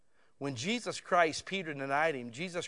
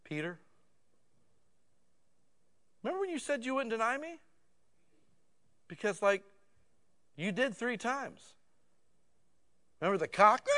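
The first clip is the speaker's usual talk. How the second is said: mock scolding; 6 semitones higher than usual. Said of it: "I would think that Jesus would say".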